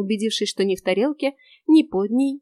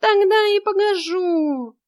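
much higher, whiny cat cry; cat yelling; 10 semitones higher, 4 dB louder, slower than usual. A narrator's reading. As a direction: yell from the corridor in cat voice. Cat Vaska voice.

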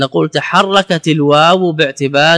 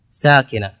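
ordinary delivery

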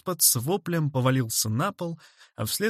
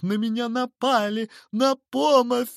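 very high, frantic cry for help; panicked wail; 11 semitones higher, 3 dB louder than usual. A narrator's reading. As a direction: yell for rescue in terror. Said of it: "вопил мистер Хорспот". (отчаянный вопль о помощи)

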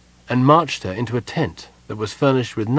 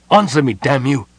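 louder, higher furious demand; angry demand; 4 semitones higher, 7 dB louder than usual. A narrator's reading. King to general, furious louder demand. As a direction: demand an answer in anger. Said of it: "Sylvan cried".

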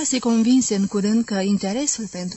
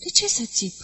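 higher, dazed bewildered question; 6 semitones higher than usual. Acted. Vera still lost before the wives, higher and dazed. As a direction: ask it as if the words make no sense. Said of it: "mai spuse Vera în neștire".

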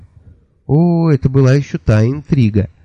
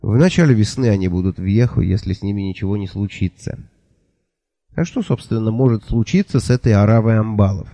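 ordinary delivery, nothing marked

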